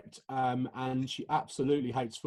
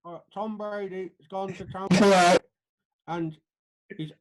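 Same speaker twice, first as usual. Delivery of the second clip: distorted audio; 6 semitones higher, 6 dB louder than usual. Real, not acted. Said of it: "Moved too much".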